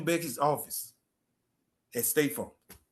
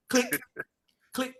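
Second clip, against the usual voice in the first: mock camera clicks; 9 semitones higher than usual. Dad snapping disposable camera.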